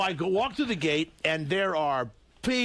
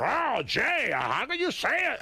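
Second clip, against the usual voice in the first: mock Larry King; 8 semitones higher, slower than usual. Imitating Larry King's whiny reply.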